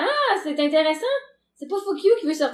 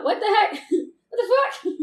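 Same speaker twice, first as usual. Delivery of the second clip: surprised exclamation; 8 semitones higher than usual. Retold or imitated. Shocked at cat outside.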